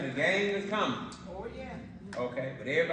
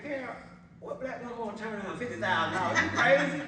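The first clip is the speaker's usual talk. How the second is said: incredulous retort; 8 semitones higher, 6 dB louder than usual. Retold or imitated. Telling off ex-wife.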